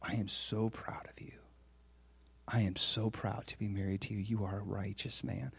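low, hushed, heartfelt praise; near-whispered, earnest pride; serious, tender wife. Retold or imitated. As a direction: say it very softly and mean every word. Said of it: "I can tell she's serious".

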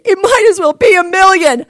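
loud, high cry of despair; retold outburst. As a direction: throw it out like it's hopeless. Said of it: "That's what I said".

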